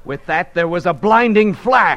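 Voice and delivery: plain reading voice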